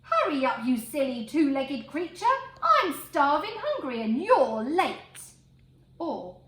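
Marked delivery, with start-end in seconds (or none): 0.0-5.2 loud, impatient bellow; a starving pig shouting at the late farmer, angry; grumpy hungry pig. Acted. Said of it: "aren't always sunny and good-tempered".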